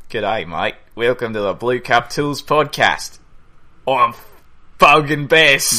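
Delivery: hammed-up Australian podcast welcome, slowed down; drawn-out fake Australian, loud on the last words; mock Australian. Host introducing the podcast in a put-on Australian accent. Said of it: "I wanna do my Australian".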